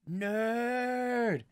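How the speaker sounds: loud, high, drawn-out taunting yell; heckling kids. Retold or imitated. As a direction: holler one long jeering word like kids heckling a stranger. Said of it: "twelve-year-old kids, they're just like".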